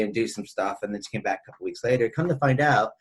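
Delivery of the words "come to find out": ordinary talk, nothing marked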